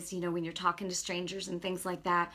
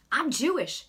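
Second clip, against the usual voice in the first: imitated retort, higher and louder; mock indignation; 5 semitones higher, 5 dB louder than usual. Stranger answering mention of Jesus, mock indignant. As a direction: mimic an affronted tough guy.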